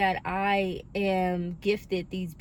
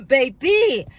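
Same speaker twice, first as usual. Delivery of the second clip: coughing; 8 semitones higher, 9 dB louder than usual. Real, not acted. Coughing fit mid-sentence.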